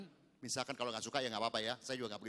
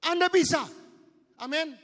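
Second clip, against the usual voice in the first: loud, high, slowed preaching; preaching at full force; 11 dB louder than usual. Acted. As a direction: press the point home to the congregation. Peak of the sermon.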